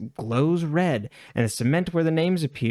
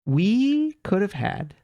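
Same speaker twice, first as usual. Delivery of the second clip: higher, real excitement building; excited; 7 semitones higher than usual. Real, not acted. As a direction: gush about the romance you wanted. Gushing about missed romance plot.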